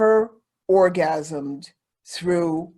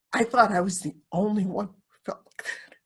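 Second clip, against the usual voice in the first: hushed, near-whispered confession; emotional whisper; faster than usual. Real, not acted. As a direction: let it fall to a whisper, moved. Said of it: "I might get a little emotional here".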